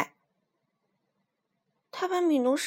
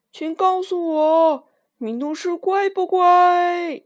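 slow, loud, higher call to the ceiling; shouting up; 7 semitones higher, 9 dB louder, slower than usual. Acted. Lion calling up to the tangled acrobats, slow loud shout. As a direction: call up to people hanging far above. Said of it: "狮子只好大声喊叫".

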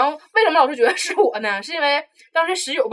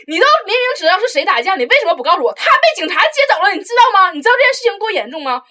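higher, louder, hammed-up scolding teacher; mock angry teacher; 9 semitones higher, 7 dB louder than usual. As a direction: imitate the teacher berating her. (夸张模仿老师训斥)

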